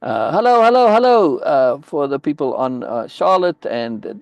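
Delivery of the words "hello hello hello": loud, high greeting; calling out hello. Real, not acted. Calling out hello to new viewers online. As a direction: call out to the viewers joining.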